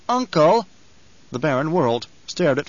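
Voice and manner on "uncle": high, loud interruption; urgent call. A narrator's reading. Nephew interrupting his uncle, urgent high call. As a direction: call out to cut him off. Feyd calls out, interrupting the Baron.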